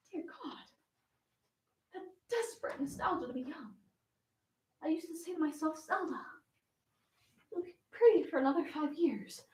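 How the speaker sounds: very soft, private confession; hushed, aching nostalgia; wistful lament. Acted. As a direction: murmur it to yourself with longing. Private lament over fading youth.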